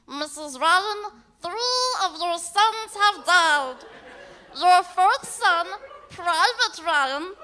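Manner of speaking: squeaky high helium voice, slow. Pretend. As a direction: talk like you just inhaled helium. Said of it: "something like this instead".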